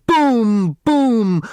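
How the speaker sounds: big exploding boom; loud firework boom. A narrator's reading. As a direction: blast it like a rocket bursting. Fireworks bursting.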